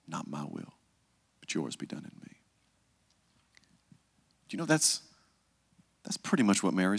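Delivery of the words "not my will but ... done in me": softer, lower, nearly whispered; hushed prayer. Shown as a demonstration. Jesus praying in Gethsemane, softer fading whisper. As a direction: let the prayer fade to a breath. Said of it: "three times".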